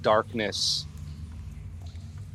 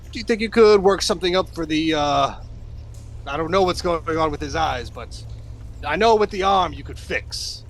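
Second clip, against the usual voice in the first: lightly voiced, higher hero voice; hero asking favour; 5 semitones higher than usual. Hero asks halfling about fixing an arm, theatrical. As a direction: put a request to your helper in character.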